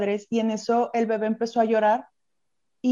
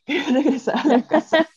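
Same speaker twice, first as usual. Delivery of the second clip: fast words breaking into higher laughter; laughing; 5 semitones higher, 5 dB louder, faster than usual. Real, not acted. Recalling anger at a happy husband, laughing. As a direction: laugh through the end of the phrase.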